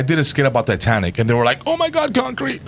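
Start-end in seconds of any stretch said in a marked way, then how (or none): 1.7-2.6 high, soft mocking gasp; mock outraged fans. Comedian imitating outraged fans, high and soft. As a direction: mimic the offended fans, pitched way up. People reacting to Titanic skit.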